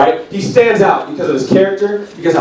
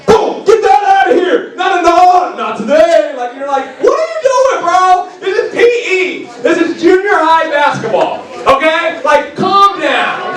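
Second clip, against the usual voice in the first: much higher, shouting trash talk; hammed-up gym kid yelling; mock PE hothead; 11 semitones higher than usual.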